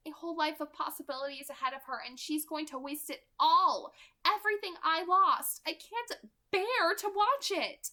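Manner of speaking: rising, anguished Rosalie, far from a whisper; a young woman grieving her own lost life; intense Rosalie. Acted. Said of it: "Rosalie whispered".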